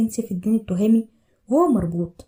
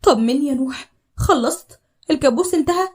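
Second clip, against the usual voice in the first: higher, louder, eager wife near tears of joy; anxious joyful plea; 6 semitones higher, 5 dB louder than usual. Acted. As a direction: beg him to tell her it's over. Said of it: "فتون قالت بهمس وهي بتعيط من الفرحة". (سؤال متلهف بفرحة وصوت أعلى)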